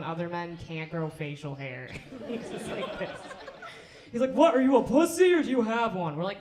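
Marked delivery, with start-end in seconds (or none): 4.3-6.2 gruff dad. Retold or imitated. Dad's temper imitated.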